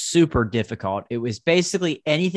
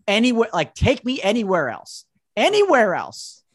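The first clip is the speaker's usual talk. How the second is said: exasperated plea; 7 semitones higher than usual. Real, not acted. Begging to escape a hated song.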